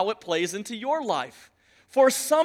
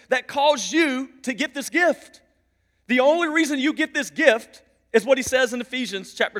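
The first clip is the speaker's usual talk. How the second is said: raised, insistent pulpit delivery; emphatic, pitched-up sermon voice; forceful preaching; 4 semitones higher, 5 dB louder than usual. Real, not acted. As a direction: hammer each phrase home to the congregation.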